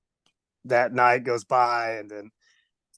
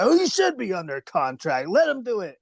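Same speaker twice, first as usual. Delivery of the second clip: lightly voiced boss, pitch climbing at the end; a hint of a big boss giving orders; Vince McMahon; 7 semitones higher than usual. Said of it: "Michael Hayes tells Vince".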